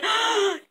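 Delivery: sharp high storyteller's gasp; gasp of amazement. Acted. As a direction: gasp in mock astonishment. Dead girl revives in story.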